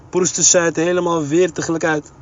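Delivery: plain reading voice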